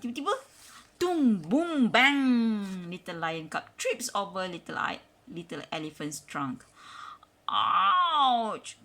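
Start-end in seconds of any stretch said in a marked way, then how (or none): 0.9-2.9 loud punchy boom bang; loud crash noises. A narrator's reading. Mother reading, making loud crash noises. Crash sound effects in story. 7.5-8.8 higher, louder pained yelp; ouch cry. A narrator's reading. Lion cub trips over trunk, yelps loudly. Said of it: "cries little lion cub".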